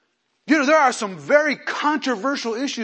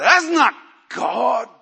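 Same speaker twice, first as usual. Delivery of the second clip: mock outrage; 3 semitones higher, 7 dB louder, slower than usual. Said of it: "And so people say".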